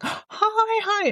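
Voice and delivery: high, bright call of hi across the room; excited greeting. Retold or imitated. Across a bar, mum greets her friend eagerly, high excited hi. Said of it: "I was going".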